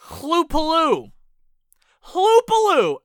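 Hloopaloo drawn out slowly and loudly, high; mock grand name. Shown as a demonstration. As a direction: savor Hloopaloo like a fanfare. Savoring a funny place name.

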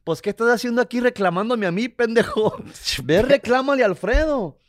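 a mild mock rant of an angry father; retold angry father. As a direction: mimic his dad yelling at him. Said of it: "Y mi jefe me dijo".

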